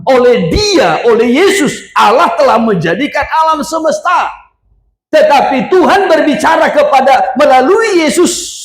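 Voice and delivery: high-pitched, driving sermon delivery; forceful preaching. Real, not acted. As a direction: preach it with rising fervour.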